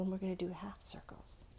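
sudden whispered aside. Acted. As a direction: drop to a breathy whisper mid-instruction. Drawing teacher suddenly whispering.

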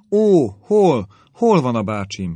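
high, loud cry of alarm; jumping up, calling for her uncle in alarm; anxious cry. A narrator's reading. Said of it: "kiáltotta Elizabeth felugorva".

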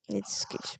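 hushed aside; whisper. Real, not acted. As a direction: whisper it under your breath. Whispered aside while ordering food.

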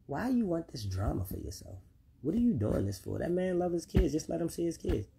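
soft, hushed, level-headed voice sinking into a whisper; calm wise adviser. Retold or imitated. Mature man counseling the mother, soft hushed reasoning. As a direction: gently talk her down like a mature elder. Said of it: "They gonna look and be like".

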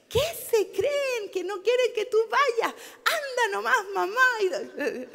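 put-on high, slow indignant child's voice, loud, ending in a laugh; son David's voice. Son urging his mother to go anyway, mock-indignant high child voice. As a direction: play the outraged young son telling mom to just go. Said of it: "creo que fue el David".

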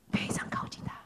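hushed whisper. Shown as a demonstration. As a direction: drop to a whisper, close in. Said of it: "微小的声音". (耳语)

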